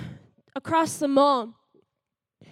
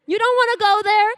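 pushing the point louder and higher; emphatic insistence; 6 semitones higher, 6 dB louder than usual. Acted. Teen pleading to mom she won't go there. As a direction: really lean on not wanting to go. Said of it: "you don't wanna go to Rose's".

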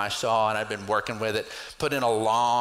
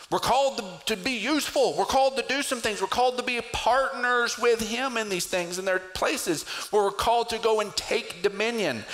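higher, driving sermon cadence; preaching; 8 semitones higher than usual. Acted. Pastor urging the congregation to act, preaching. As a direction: build it up from the pulpit.

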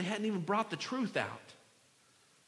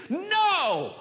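loud, high outburst; erupting refusal; 10 semitones higher, 7 dB louder than usual. Acted. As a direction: let it burst out as a shout. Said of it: "that just came up out of him".

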